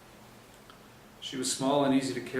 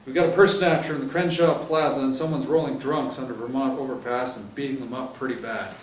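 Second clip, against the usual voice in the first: louder, higher, brisk orders; stern sergeant briefing; 4 semitones higher, 9 dB louder than usual. Acted. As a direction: lay out the patrols firmly.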